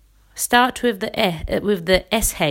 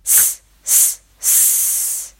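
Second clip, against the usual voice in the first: quick unvoiced s bursts, each one cut off; s s s in small belly kicks; three short hisses; much slower than usual. Shown as a demonstration. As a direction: hiss out three short puffs of air. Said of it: "I'll demonstrate".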